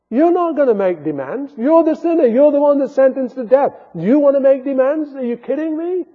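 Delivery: high, loud, driving rebuke; forceful preaching. Real, not acted. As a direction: press the point on the sinner.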